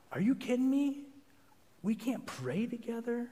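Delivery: high, soft incredulous questions; crushed disbelief. Retold or imitated. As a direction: imitate a wife suddenly crushed and disbelieving. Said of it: "went from super happy to like".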